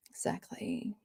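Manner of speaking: a dry, breathy whispered aside. Real, not acted. Reading a tweet, she whispers her agreement. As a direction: mutter it under your breath, voiceless.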